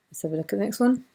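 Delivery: usual speaking voice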